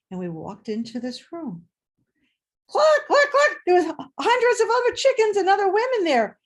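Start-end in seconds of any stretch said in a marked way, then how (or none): 2.7-3.6 high, loud clucking chicken imitation. Room full of chickens. 3.7-6.4 higher and louder, caught up in the memory; excited. Real, not acted. Excited recollection of chicken room.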